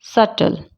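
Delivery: सटल with a clean middle t; सटल, no lip stop mid-word; silent B. Shown as a demonstration. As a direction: say सटल as suttle. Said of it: "क्या बोलते हैं इसको?".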